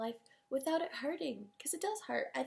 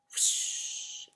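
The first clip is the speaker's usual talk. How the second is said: long airy whoosh of power; imitated whoosh; 3 dB louder than usual. Shown as a demonstration. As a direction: make a rushing burst-of-magic noise. Imitates sudden burst of power.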